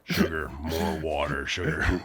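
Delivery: low, slow alien farmer drawl. Pretend. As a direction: growl it like the bug in Edgar's skin. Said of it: "I imagine he talks like the guy in Men of Bl".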